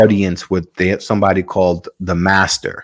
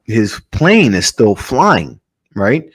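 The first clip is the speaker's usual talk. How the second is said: ordinary talk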